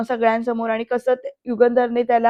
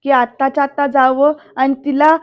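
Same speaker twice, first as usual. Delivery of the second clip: usual speaking voice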